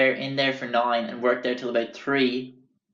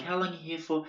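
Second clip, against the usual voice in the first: put-on higher, softer Long Island boyo; Long Island accent; 5 semitones higher, 6 dB quieter, faster than usual. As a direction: imitate a curious Long Island local. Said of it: "real Long Island boyos".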